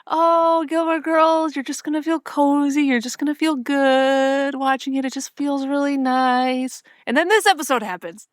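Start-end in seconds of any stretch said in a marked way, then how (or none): 0.1-6.8 higher, slow, sing-song mock of the co-host's cozy pitch; mocking imitation. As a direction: tease her sweet sales pitch, drawn out. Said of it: "what you described this whole time".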